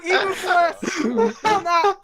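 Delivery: slow, loud, high words cracking with laughter; laughing through it. Real, not acted. Reader laughing too hard to continue, loud. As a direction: try to read but crack up. Restarting line while laughing.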